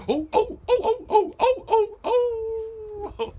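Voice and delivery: high, loud, howling bay; ludicrous dog howl. Acted. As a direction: howl like a hound. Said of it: "the dog would bay".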